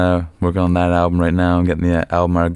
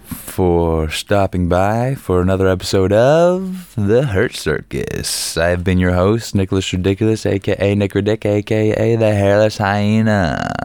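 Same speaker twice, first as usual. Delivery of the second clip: show host outro; 3 semitones higher, slower than usual. Acted. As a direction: sign off like a showman.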